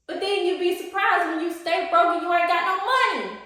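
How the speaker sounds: mock scold. Real, not acted. Vlogger scolding her viewers for calling themselves broke.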